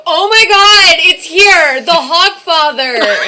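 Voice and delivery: high, loud mock squeal of excitement; imitated excited sister. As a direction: imitate a sister's freak-out, drawing out the end. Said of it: "I just hear my sister go".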